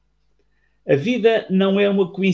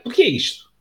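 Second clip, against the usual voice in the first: put-on uneasy child; 8 semitones higher than usual. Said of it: "começou a ficar inquieto e perguntou ao pai".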